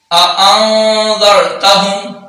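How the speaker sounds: अअंदरतहुम slow with the noon hidden, nasalised; hidden nasal noon. Shown as a demonstration. Teacher shows ikhfa to students. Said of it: "अब नून पर इख्फा होगा".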